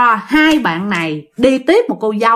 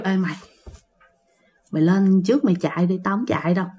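low, soft imitation of a scared friend; hushed voice; 4 semitones lower, 7 dB quieter, faster than usual. Friend quietly refusing to drive past the bridge, hushed. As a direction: keep it small and low, barely projected. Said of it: "nó nói nhỏ nhỏ nhỏ vậy đó".

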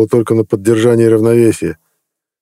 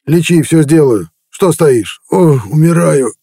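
gruff chieftain in pain; 5 semitones higher than usual. A narrator's reading. Sick chieftain begs Ned to heal him, pained and rough. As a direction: bargain desperately through the cramps.

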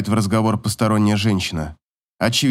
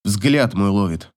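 ordinary delivery

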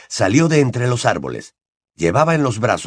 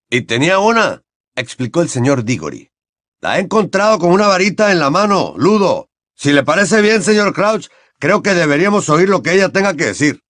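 higher, louder firm insistence; insistent Mr Diggory; 7 semitones higher, 3 dB louder than usual. Acted. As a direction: Mr Diggory insisting they question the elf.

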